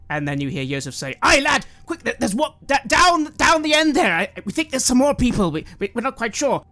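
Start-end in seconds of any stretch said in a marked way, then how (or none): 1.2-6.6 high, loud, flustered hurry; urgent calling. Acted. Yosef to the lad about more prisoners, urgent calling. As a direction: stammer out directions urgently.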